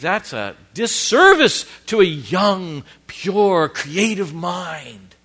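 a hint of a prim, scolding critic; skeptical critic. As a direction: play the shocked critic who scolds Steve.

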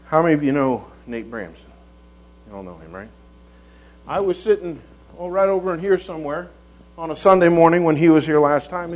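ordinary talk

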